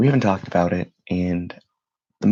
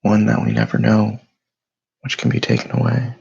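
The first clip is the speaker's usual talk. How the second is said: guided meditation; 5 dB louder than usual. Acted. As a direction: lead them through stillness, unhurried and steady. Leads a morning meditation.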